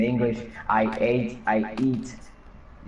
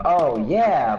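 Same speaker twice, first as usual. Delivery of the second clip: a mock character line, louder and higher; imitating the English game quote; 6 semitones higher, 6 dB louder than usual. Quoting the English voice line, a bit raised. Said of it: "one of the quotes is yes or oh yeah".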